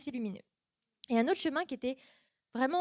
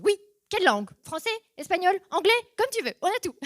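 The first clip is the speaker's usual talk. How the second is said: higher and louder, bursting with enthusiasm; eager offer; 8 semitones higher, 7 dB louder than usual. Retold or imitated. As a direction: gush about every language on offer. Friends eagerly offering Bibles.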